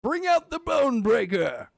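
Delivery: a mock goblin order; goblin shout. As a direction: bellow it to the goblin horde. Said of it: "Love, I, God".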